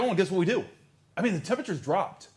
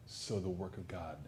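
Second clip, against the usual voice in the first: ordinary talk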